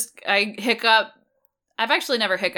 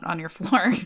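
usual speaking voice

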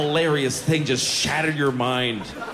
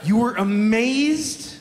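slow, drawn-out disbelief on "amazed"; incredulous; 2 semitones higher, slower than usual. Acted. Comedian challenging someone amazed by chicken wings, incredulous. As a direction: question it slowly, incredulously. Mock disbelief at casual hyperbole.

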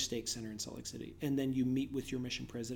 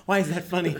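high, loud Scottish protest; amused, mock-indignant Scottish accent; 5 semitones higher, 10 dB louder than usual. Shown as a demonstration. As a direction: ask it laughing in put-on Scottish. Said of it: "you sound like William Wallace".

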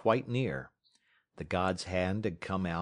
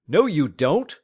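loud, higher flat refusal; forbidding shout; 6 semitones higher, 11 dB louder than usual. Acted. Scott forbidding Matt to shoot, loud refusal. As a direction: stop him cold.

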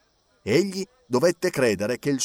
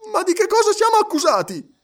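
very high, loud exasperated cry; impatient exclamation; 5 dB louder than usual. A narrator's reading. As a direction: demand it with exasperation. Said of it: "esclamò Passepartout spazientito". (Passepartout spazientito, acuto e forte)

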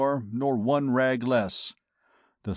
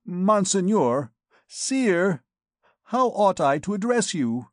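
higher, louder, halting plea; nervous stammering; 7 semitones higher, 3 dB louder than usual. A narrator's reading. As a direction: fumble for the right title, scared. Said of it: "stammered he".

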